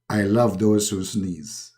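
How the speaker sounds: low, slow solemn God voice; slow deep Allah voice. Acted. God revealing secret.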